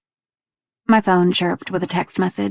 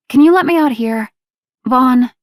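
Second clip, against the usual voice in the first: higher and louder, pressing the request; raised voice; 5 semitones higher, 6 dB louder than usual. A narrator's reading. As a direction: call it out a bit urgently. Asking to be let out.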